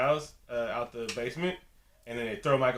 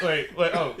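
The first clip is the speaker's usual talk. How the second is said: spooked; 4 semitones higher, 6 dB louder, slower than usual. Retold or imitated.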